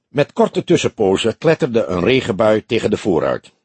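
ordinary delivery

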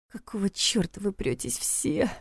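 slow hushed muttered swearing; inner cursing. A narrator's reading. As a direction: curse under the breath, in thought. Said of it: "мысленно выругалась она".

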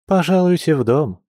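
high, teasing invitation; barely hidden smirk. Acted. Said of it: "с едва скрываемой ухмылкой произнесла Маша".

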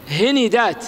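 هندات said hinidāt, i on both h and n; second vowel follows first. Shown as a demonstration. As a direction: say هندات with a short i after the n. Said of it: "تتبعها الحركة". (هندات بكسر الهاء والنون)

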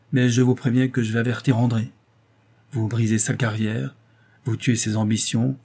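ordinary delivery

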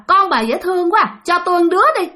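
high, wheedling spirit voice; covetous ghost. Acted. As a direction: coo over the child in a high ghostly voice. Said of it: "Lại cười tiếp".

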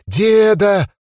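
high shrieking cry for grandpa; panicked shout. Acted. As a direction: scream it out in panic. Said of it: "трясся и повторял".

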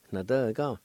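"Na, de, ga" run together as one word; connected take of "Na, de, ga"; joined-up pronunciation. Shown as a demonstration. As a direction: blend "Na, de, ga" smoothly. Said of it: "It's pronounced like this".

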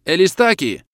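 loud, high warning shout. A narrator's reading. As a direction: cry out in alarm as you run. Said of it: "кричал один анголец". (громкий высокий крик)

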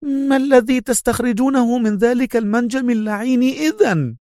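high-pitched nervy outburst; woman's exasperated high demand; irritable, on edge. A narrator's reading. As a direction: exclaim in exasperation, voice held high. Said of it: "فهتفت في عصبية".

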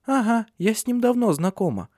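high-pitched child acting grown-up; grown-up little girl. A narrator's reading. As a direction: answer as a child putting on adult airs. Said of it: "по-взрослому ответила Суль".

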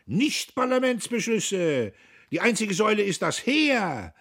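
high, loud, impassioned shouting; wild shout. A narrator's reading. Buck outshouting Diederich, wild and loud. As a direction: swing into it even wilder. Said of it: "rief Buck mit jähem Schwung und noch wilder".

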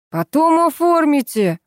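high, slow, loud whine; whining. A narrator's reading. Vasya begging to skip the paperwork, whining. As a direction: whine impatiently. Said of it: "заныл Вася".

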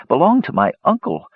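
understated distress; low, shaking voice. A narrator's reading. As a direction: insist on it while badly shocked. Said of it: "in a low, shaking voice".

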